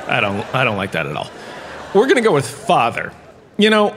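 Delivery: ordinary talk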